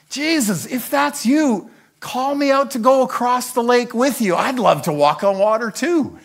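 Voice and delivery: high, loud eager call; thrilled, high-pitched plea; excited Peter. Acted. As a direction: shout it like you can't wait to join in. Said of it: "Peter gets all excited".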